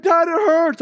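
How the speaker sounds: put-on high, loud pained little kid; whiny kid. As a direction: play the son who's hurting. Son complaining to dad.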